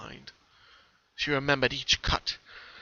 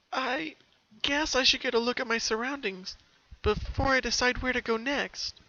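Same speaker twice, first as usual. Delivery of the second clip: put-on much higher girlish voice; unsure but deciding; pony anxiously plans to look around; nervous Pinky; 11 semitones higher than usual. A narrator's reading. Nervous resolve.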